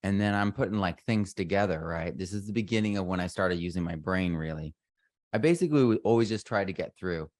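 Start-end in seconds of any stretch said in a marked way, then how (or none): none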